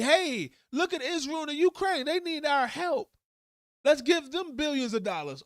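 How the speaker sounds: a lightly hammed-up congressman pushing aid; mock eager politician. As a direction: sell the foreign aid like a smooth lawmaker. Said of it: "the moment they wanna give a country".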